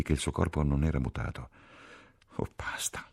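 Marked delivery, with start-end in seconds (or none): 2.4-3.1 soft, whispered sob; sobbing. A narrator's reading. Ferguson has broken down crying, sobbing softly. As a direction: breathe it out, overcome by tears. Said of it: "singhiozzò la Ferguson".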